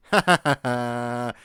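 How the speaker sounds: slow, loud hammed-up villain laugh; playful evil laugh; mock villain laugh. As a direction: cackle as if you've just won. Gloating over forcing viewers to watch.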